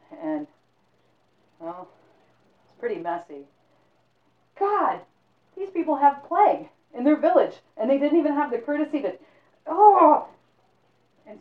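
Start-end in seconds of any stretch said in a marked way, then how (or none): 4.6-10.3 high, loud horrified rant; disgusted outrage. Acted. Man finds plague dead, loud and appalled. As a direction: rant in horror, pitched up and loud.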